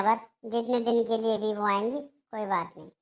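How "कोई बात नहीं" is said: sulky, much softer resignation; resigned sigh. Acted. Daughter-in-law to mother-in-law, sulking and soft. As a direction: let the words sink away, wounded.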